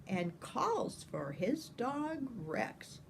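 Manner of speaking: plain reading voice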